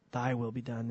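low, soft surrender; total submission. Acted. Jesus praying to the Father in Gethsemane, submissive. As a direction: yield quietly, voice dropped low. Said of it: "in total submission to the Father".

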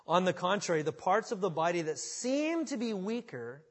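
loud, ringing Bible reading; forceful scripture reading. Acted. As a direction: proclaim the verse to the room.

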